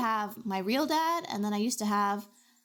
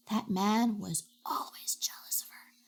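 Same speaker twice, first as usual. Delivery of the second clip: gossipy whisper. Real, not acted. Gossiping about jealous stepfather.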